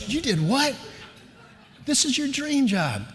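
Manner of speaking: high-pitched mock disbelief, slowing down; shocked wife. Wife hearing he may refuse the job, high incredulity. Wife's shocked reaction, acted.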